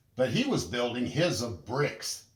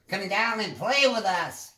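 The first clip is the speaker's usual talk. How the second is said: high, louder call to come play; 8 semitones higher, 4 dB louder than usual. Acted. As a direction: call up to the brother on the wall. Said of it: "he called".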